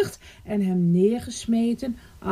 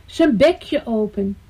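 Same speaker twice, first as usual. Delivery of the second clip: ordinary delivery, nothing marked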